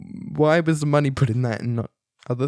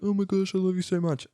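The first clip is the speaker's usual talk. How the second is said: a hint of a teen girl gushing back, higher and softer; mock gushing reply; 7 semitones higher, 3 dB quieter than usual. Chloe answering her brother on the phone, softer mock gushing. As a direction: echo the love line more gently. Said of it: "and Chloe's like".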